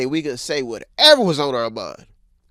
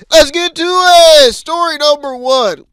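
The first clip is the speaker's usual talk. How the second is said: louder, higher, slow announcer call; announcer hype; 12 semitones higher, 9 dB louder than usual. Acted. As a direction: launch the segment like a show host. Host kicks off the stories.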